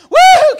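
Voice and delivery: piercing high "woo!"; full-volume mock holler; imitated yell. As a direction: let out a very loud, high whoop, imitating an excited yell. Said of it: "she's yelling out".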